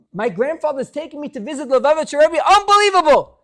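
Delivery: fast, rising to a high, loud burst; boy bursting with excitement; thrilled boy. Acted. Grandson bragging about visiting the Rebbe, thrilled and loud.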